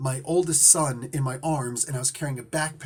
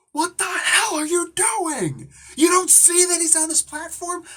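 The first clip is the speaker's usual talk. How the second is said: retold furious scream; 13 semitones higher, 5 dB louder than usual. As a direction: re-enact screaming at someone in alarm. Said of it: "I actually screamed at her".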